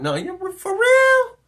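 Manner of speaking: very high, loud, drawn-out question; acted disbelief. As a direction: squeal it in mock astonishment. Acting out an incredulous question.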